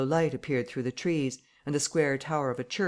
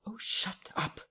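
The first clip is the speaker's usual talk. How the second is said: hissed; 8 semitones higher, 5 dB quieter, slower than usual. Acted. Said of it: "with sudden amazing vigour".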